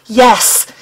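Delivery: ordinary delivery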